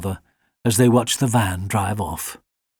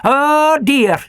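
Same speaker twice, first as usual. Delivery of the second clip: collie's bark; 6 dB louder than usual. A narrator's reading.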